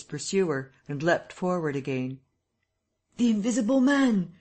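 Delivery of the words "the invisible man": high, loud alarmed cry; fleeing man cries out a warning. Acted. Fleeing Kemp cries to the navvies, high and loud. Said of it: "he cried to the navvies".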